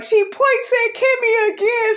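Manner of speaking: squeaky high mock voice of Martell. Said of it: "Martell was like".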